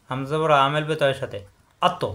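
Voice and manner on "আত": আত with a bounced final ত; qalqalah bounce. Shown as a demonstration. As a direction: release ত in আত with a short echo. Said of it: "বাড়ি খাবে".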